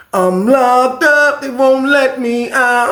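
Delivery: slow, high, laughing-crying mock whine; whining neighbor. Imitating the locked-up neighbor wailing, mock crying. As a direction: ham up the neighbor's pathetic wail. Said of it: "I wake up to".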